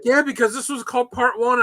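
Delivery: lightly mimicked girl arguing; girl explaining. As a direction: lift way up and play her as sure of it. Quoting the girl's reasoning.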